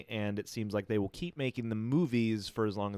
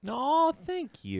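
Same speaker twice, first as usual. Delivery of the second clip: high, drawn-out "aw, thank you"; mock-touched; much slower than usual. Co-host answering a second-favorite compliment, mock-touched. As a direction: gush slowly in a high pitch.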